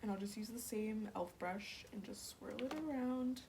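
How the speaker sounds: ordinary talk, nothing marked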